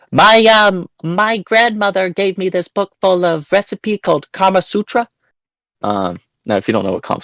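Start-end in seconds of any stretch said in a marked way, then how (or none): 0.1-5.1 very high-pitched silly caller voice; put-on prank voice. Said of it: "doing voices".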